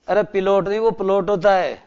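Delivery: ordinary talk, nothing marked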